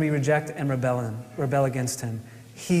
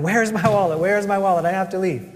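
imitating a frantic search, pitched up and louder; mildly panicked, higher-pitched re-enactment; 4 semitones higher, 4 dB louder, faster than usual. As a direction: act out a rushed, frantic call. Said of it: "I'm frantically running around going".

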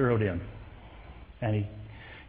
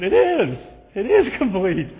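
much higher and louder, bursting with joy; joyful exclamation; 7 semitones higher, 8 dB louder than usual. Retold or imitated. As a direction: shout the good news to him. Joyful affirmation to his dad.

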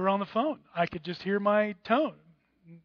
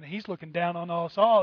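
usual speaking voice

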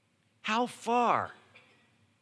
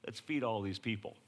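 lower, softer smirking offer; 6 semitones lower, 7 dB quieter than usual. Acted. Jesus teasing Philip, sly smirk. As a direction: tease him quietly with a knowing grin. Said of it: "the smirk on Jesus' face".

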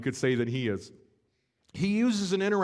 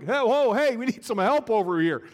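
higher, louder shout across the water; calling for help; 5 semitones higher, 5 dB louder than usual. Acted. Fishermen overwhelmed by the catch, shouting for help. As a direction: yell to the other boat for help.

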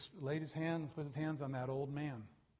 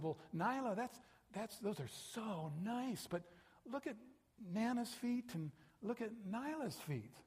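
tender grandma; 8 semitones higher than usual. Retold or imitated. Grandma gently declines the shoes.

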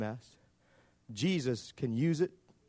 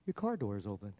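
computer voice; 6 semitones lower, 7 dB quieter than usual. Retold or imitated. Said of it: "the computer voice go".

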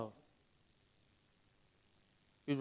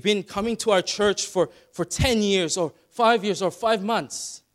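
louder, higher, fervent preacher's appeal; impassioned pulpit voice; preaching at full force; 5 semitones higher, 7 dB louder than usual. Acted. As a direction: press the call home to the congregation. Preaching altar call with force.

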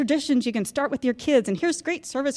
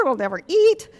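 normal talking voice

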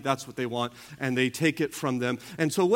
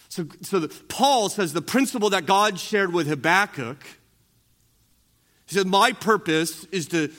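raised pulpit voice; preaching at full force; 4 semitones higher, 4 dB louder than usual. Acted. Pastor preaching on Paul quoting Habakkuk, full force. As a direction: lift it up and proclaim.

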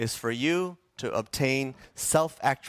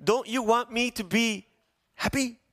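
whiny plea; 8 semitones higher than usual. Retold or imitated. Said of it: "we say to our friends".

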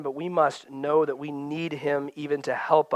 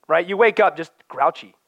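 ordinary delivery

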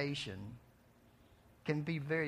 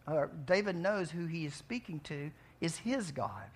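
usual speaking voice

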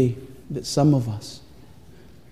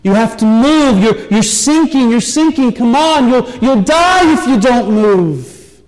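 high, loud desperate calls to the horse; crying out; 10 semitones higher, 6 dB louder than usual. A narrator's reading. Atreyu calling his sinking horse, loud and high. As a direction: shout for the horse to move before it drowns. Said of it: "Atreyu begins crying out".

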